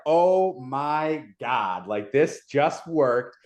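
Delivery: slow, drawn-out disbelief; replaying an awestruck reaction, very slowly; retold amazement. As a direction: stretch each word in stunned wonder. Retells stunned reaction to winning vote.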